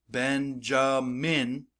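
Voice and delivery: Benjamin slowly in three beats, BEN-JA-MIN; syllable by syllable. Shown as a demonstration. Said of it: "my jaw goes down three times".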